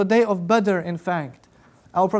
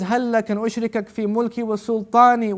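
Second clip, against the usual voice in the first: rapid Arabic quote; 2 semitones higher, much faster than usual. Real, not acted. Quoting the Arabic narration fast.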